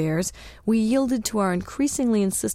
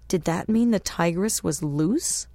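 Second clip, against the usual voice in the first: ordinary delivery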